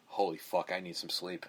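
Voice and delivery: exhausted under-the-breath mutter; tired mutter to self. Retold or imitated. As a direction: mumble it, worn out, to yourself. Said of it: "I caught myself going".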